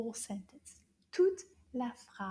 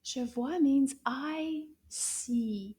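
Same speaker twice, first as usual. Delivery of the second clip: slow explaining; much slower than usual. Shown as a demonstration. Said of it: "do you know what je vois means?".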